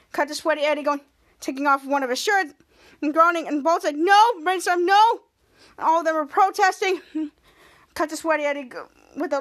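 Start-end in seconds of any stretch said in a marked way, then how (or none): 4.0-5.2 high, loud cries of no; mock protest. Heroes protest the sweaty shirt with high, loud cries of no. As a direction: cry it out as the villain strips off his shirt.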